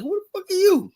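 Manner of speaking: very high, sharp retort; mock defensive snap. Confronting a stranger in his face, high mock snap. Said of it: "I was like".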